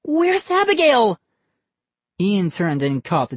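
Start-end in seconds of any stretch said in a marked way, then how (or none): 0.0-1.2 much higher, louder panicked question; frantic for her missing doll; alarmed demand. A narrator's reading. Molly demands to know where her doll is, panicked. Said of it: "Molly demanded".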